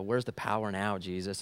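very quiet, low and deflated; retold defeat. Defeated student asks Jesus after failing, low dejected voice. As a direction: mutter a dejected prayer, as if retelling it. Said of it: "I'm just, I'm defeated".

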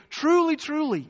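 "Truly, truly" high and ringing; solemn emphasis. Shown as a demonstration. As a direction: proclaim it with weight. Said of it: "it's his way of saying".

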